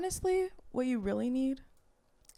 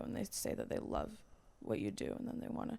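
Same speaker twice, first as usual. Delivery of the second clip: whispering; 10 semitones lower, 9 dB quieter than usual. Acted. Whispered seductive fantasy.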